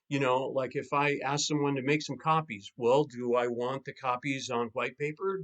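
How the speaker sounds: usual speaking voice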